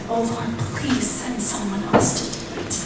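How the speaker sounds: softly voiced pleading Moses; meek plea. Moses begs the Lord to send someone else, meek plea.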